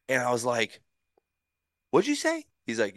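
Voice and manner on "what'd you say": very high, disbelieving voice; incredulous question. Retold or imitated. Pitcher to skipper on making the team, incredulous. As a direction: squeal it as if you misheard.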